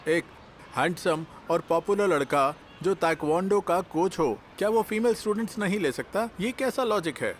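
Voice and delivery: high-pitched, incredulous arguing; indignant protest. Acted. Studio owner defending his plan to colleague, indignant. Owner argues back at objection.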